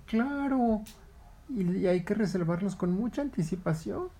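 high, knowing heron voice. Acted. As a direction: lecture him like an old bird. Heron lectures.

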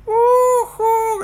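high, loud whoop; gleeful shout. Acted. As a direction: whoop as you shoot down a slide. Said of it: "gritó Palito Jackson". (grito agudo de emoción)

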